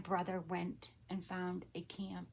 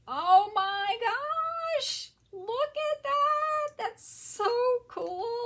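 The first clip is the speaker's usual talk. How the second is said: very high, loud, drawn-out squealing; ecstatic, stretched-out high cry; thrilled squeal; 11 dB louder, much slower than usual. Real, not acted. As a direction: squeal slowly with sheer delight. Thrilled by a find in box.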